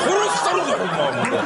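high comic threat through laughter; hammed-up angry laugh; laughing mock fury. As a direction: fake rage while cracking up. Fuming again, laughing.

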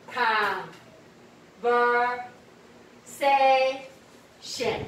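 "conversation" very slowly, each syllable held apart. Shown as a demonstration. Teacher has students count the syllables, "conversation" said syllable by syllable. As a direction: break "conversation" into slow, separate syllables. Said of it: "conversation几个音节".

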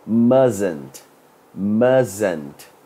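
Mustn't said as "MUZ-ent", the t of must dropped; mustn't pronunciation. Shown as a demonstration. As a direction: say Mustn't as two syllables, MUZ-unt, no t in the middle. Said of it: "Aí fica uma pronúncia um pouquinho estranha".

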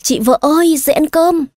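high sudden call right into her ear; husband shouting his wife awake. A narrator's reading. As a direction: yell to wake her, childishly high. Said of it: "ghé vào tận tai cô mà hét".

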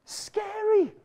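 "Scary" thrown way up high; high, mock-spooky "Scary"; high playful echo. Real, not acted. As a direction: squeal the word in mock fright. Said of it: "it's scary".

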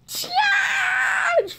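very high, slow words breaking into laughter; real helpless laughter; laughing shriek. Real, not acted. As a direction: squeal it through laughter. Laughing hard at movie scene.

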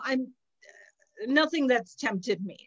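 normal talking voice

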